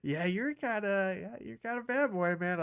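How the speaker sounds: partner's teasing. Retold or imitated. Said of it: "Even my partner was like".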